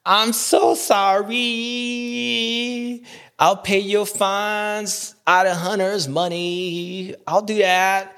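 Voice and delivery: drawn-out, sing-song mock apology; slow, exaggerated imitation of a whiny apology; Jet Lawrence apologizing. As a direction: stretch every word in a hammed-up sorry. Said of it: "you got Jet Lawrence, like".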